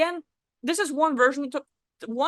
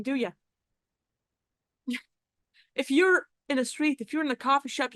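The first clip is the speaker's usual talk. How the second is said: normal talking voice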